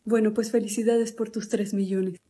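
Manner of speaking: normal talking voice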